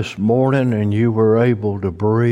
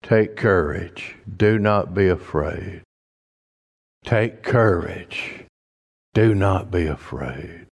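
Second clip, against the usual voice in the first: slower, softer, comforting words; slow reassuring repeat; 3 semitones lower, 3 dB quieter than usual. Shown as a demonstration. Preacher reassuring the church, slow and soft. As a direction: let each phrase sink in gently. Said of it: "what a church needs to hear today".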